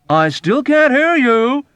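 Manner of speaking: high, loud, slow; patiently complaining; tolerant complaint. Acted. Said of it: "Arfie complained tolerantly".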